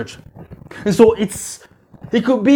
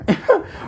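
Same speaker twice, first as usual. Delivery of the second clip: laugh; 9 semitones higher, 4 dB quieter than usual. Real, not acted. Laughs after joke.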